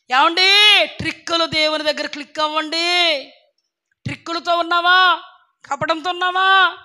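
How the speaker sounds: loud, high fiery rebuke from the pulpit; preaching at full force. Acted.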